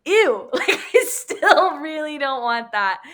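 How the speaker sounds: laughing mock disgust, high. Congregation member balking at trials, laughing mock disgust. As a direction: laugh through a grossed-out ew. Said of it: "And you're like".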